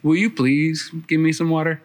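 a courteous ask with weight on you; polite request. Shown as a demonstration. The polite way Jesus could have asked, courteous. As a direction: ask nicely, the way grandmother taught. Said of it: "didn't say".

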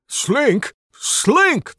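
high, loud indignant yell. Acted. Rogue outraged at his job being taken, yelled. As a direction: rise from question to outrage. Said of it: "the rogue yells".

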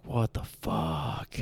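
low, soft, drawn-out disbelief; hushed disbelief. Retold or imitated. Driver retelling the engine dying on him, hushed slow disbelief. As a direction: stretch it out slowly, low and soft. Retold dismay as engine dies.

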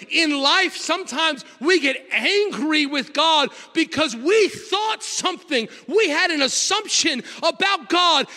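high, driving preacher's build; fiery sermon delivery; preaching at full force. Acted. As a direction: preach it up to the congregation. Preaching at full force, raised pitch.

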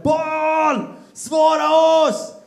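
high, loud, drawn-out cry to a god; desperate cry. Acted. Baal's prophets crying out to their silent god, loud and drawn out. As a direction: call out to Baal, slow and pleading.

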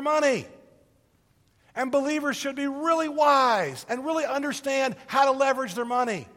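rising, loud preacher's cadence; full-force preaching. Acted. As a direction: drive the point home to the congregation.

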